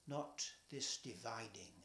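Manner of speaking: plain reading voice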